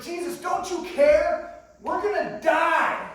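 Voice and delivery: high, slow, drawn-out desperate shout; panicked yelling. Acted. Disciples waking Jesus in the storm, desperate yelling. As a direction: yell it as if the boat is sinking. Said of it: "they're yelling at Jesus".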